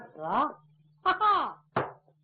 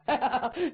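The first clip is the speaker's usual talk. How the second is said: laugh. Real, not acted. Storyteller laughs.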